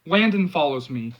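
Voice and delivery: usual speaking voice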